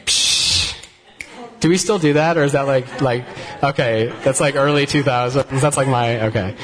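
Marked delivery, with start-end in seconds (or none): 0.1-0.7 dismissive scoff. Retold or imitated. Mock dismissal of the pastor.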